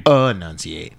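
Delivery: "Enunciate" drawn out, each syllable clear; careful enunciation. Shown as a demonstration. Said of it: "how I just did that".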